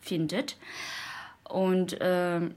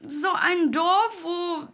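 ordinary delivery

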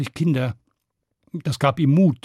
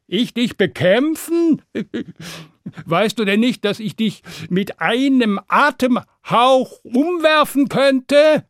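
high, mounting boast with a scoffing laugh; scoffing braggart; 8 semitones higher, 4 dB louder than usual. Acted. Stan bluffing that his breath could topple the dragon, scoffing. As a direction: brag grandly, building to a loud finish.

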